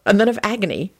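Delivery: plain reading voice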